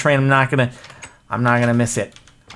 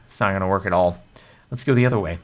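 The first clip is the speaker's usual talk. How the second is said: ordinary delivery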